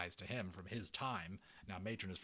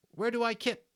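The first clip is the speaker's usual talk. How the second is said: put-on high loud scoffing kid voice; exasperated boy; 8 dB louder than usual. Patrick mocking Omri for missing the belly, high scoff. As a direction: roll your eyes at your friend.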